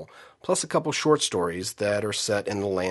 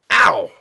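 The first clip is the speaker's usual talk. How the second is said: pained yelp; 13 semitones higher, 11 dB louder than usual. Acted. Kicked by sergeant.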